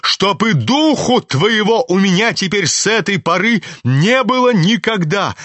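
an outraged mother's high angry cry. A narrator's reading. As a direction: fling the banishment at him in rage. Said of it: "побледнев от гнева".

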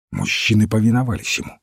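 usual speaking voice

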